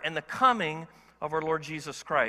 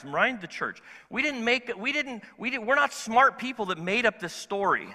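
normal talking voice

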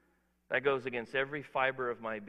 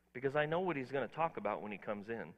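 ordinary talk

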